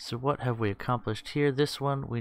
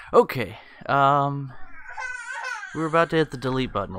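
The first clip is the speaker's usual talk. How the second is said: laughing; 4 semitones higher, 6 dB louder, faster than usual. Real, not acted. Still laughing afterwards.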